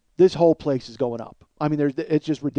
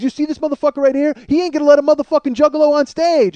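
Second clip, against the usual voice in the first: high, loud call-out to a crowd; single him out for the mob; rabble-rousing shout; 10 semitones higher, 7 dB louder than usual. Retold or imitated. Rapper denouncing the guard to the fans, rabble-rousing shout.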